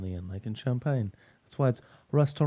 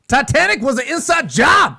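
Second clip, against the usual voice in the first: higher, louder mock conspiracy yell; conspiracy shout; 10 semitones higher, 7 dB louder than usual. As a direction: yell it like a crazed theorist.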